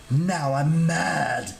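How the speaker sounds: slow, strained outrage; drawn-out angry god voice; furious shriek. A narrator's reading. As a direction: stretch the words in petty fury. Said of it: "Terminius shrieked".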